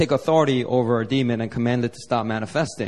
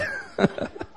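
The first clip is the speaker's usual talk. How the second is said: laugh; 5 dB quieter than usual. Real, not acted. Laughs at his own mistake.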